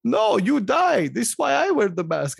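put-on high, emphatic Eastern European accent; mock Eastern European man, slow and insistent. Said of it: "This guy's, like, full on, like".

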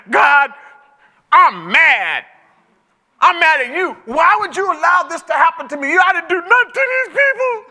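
loud, high frustrated complaint to God; angry prayer. Retold or imitated. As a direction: cry out mad at God, voice rising high. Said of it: "I'm like".

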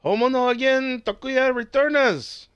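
high, slow fake-Japanese title read; mock Japanese accent. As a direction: announce it in a hammed-up Japanese accent.

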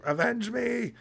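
high, hammy dying cry; overacted death scene. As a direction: play it like a melodramatic death. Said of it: "She's like".

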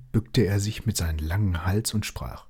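plain reading voice

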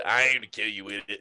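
lightly voiced drawling Southern man; Sling Blade voice. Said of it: "And Sling Blade".

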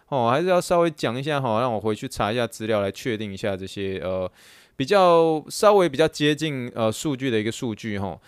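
ordinary talk, nothing marked

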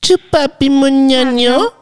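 high, slow meme squeal; excited anime fan. Acted.

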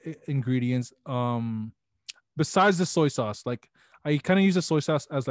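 ordinary talk, nothing marked

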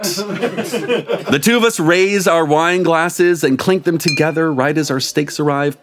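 plain reading voice

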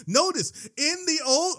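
high, loud preacher's excitement; getting excited. Real, not acted. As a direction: launch in high and worked-up. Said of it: "oh, I'm getting excited".

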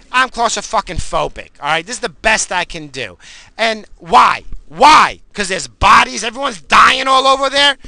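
exaggerated angry comeback, building to loud; aggressive retelling. Upset customer answering a man about his mask, aggressively exaggerated. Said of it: "be as aggressive as I'm telling you".

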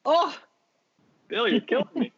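playful outrage at a friend; mock anguish. Real, not acted. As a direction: squeal it up high like you've been wounded. Friend dismisses favourite director.

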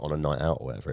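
ordinary talk, nothing marked

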